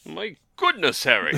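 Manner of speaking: put-on laughing old wizard exclaiming; Dumbledore impression. Co-host answers the science lesson as Dumbledore, laughing. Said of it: "That's what Dumbledore sounds like".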